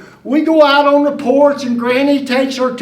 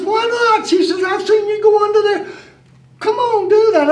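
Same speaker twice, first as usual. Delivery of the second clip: higher grandmother voice, coaxing; Granny coaxing; 8 semitones higher, faster than usual. Retold or imitated. Granny urges the boy under the house, coaxing high voice. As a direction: play the old granny nudging the boy along.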